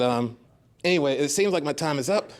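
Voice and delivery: usual speaking voice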